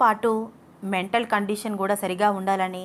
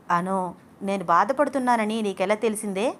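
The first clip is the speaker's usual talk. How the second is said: usual speaking voice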